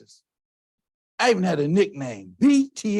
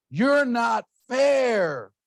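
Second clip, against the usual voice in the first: slow, loud shout of "You're not fair"; shouted protest; 7 semitones higher, 3 dB louder, much slower than usual. Retold or imitated. A son shouting at his mom, slow and loud. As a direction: yell "You're not fair" slowly, like a hurt kid. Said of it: "Remember when I told my mom".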